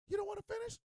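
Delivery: high-pitched partner's voice; puzzled partner. Acted. Voices partner asking him.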